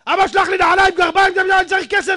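loud, high angry yelling of the letter; angry shouting read. Retold or imitated. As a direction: bark the son's letter out like a furious neighbor. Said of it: "מה, הוא צועק עליי?".

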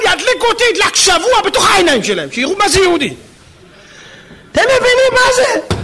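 high-pitched, fervent exclamation; preacher at full pitch; impassioned preaching. Real, not acted. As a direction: cry out the climax in amazement, high and strained. Preacher climaxes in passionate awe.

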